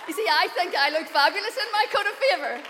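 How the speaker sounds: loud, high joking brag about looking fabulous; hammed-up boast. Real, not acted. Guest preacher showing off her gifted coat, hammed-up brag.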